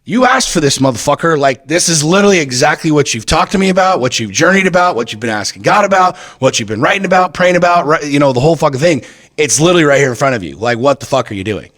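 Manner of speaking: high-pitched, loud scolding voice of God; a God's-eye scolding, pitched well up; God calling him out. Retold or imitated. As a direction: play God as an exasperated parent who calls him on his nonsense. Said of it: "kind of like God going".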